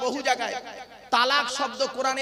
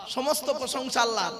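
usual speaking voice

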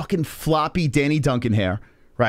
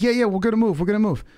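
higher imitated placating voice; placating girl imitation; 5 semitones higher, faster than usual. Girl giving in to the angry driver, lightly imitated placating. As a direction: lightly voice her hurried agreement.

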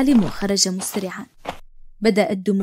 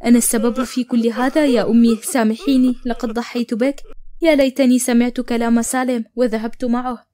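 plain reading voice

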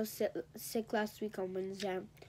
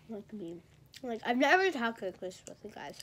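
ordinary talk, nothing marked

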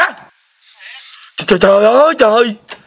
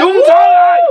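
much higher, louder jubilant yell; ecstatic shout; 5 dB louder than usual. Acted. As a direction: scream it out like you just got rich. Son hears mother won lottery.

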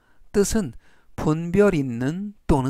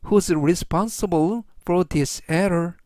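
slow model sentence; much slower than usual. Shown as a demonstration. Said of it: "전체적 의미는".

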